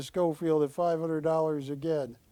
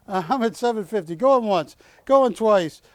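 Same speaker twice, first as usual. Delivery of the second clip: loud, higher, rapid auction call; auctioneer shout; 7 semitones higher, 8 dB louder than usual. Acted. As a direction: bang the sale home. Auctioneer closing the sale.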